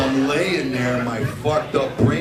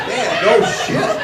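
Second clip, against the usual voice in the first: higher and louder, slow and drawn out; mock loud agreement; 9 semitones higher, 6 dB louder, slower than usual. Him answering his wife in bed, hammed up loud and slow. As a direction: hams up his own reply, slow and loud. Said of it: "and I'm like".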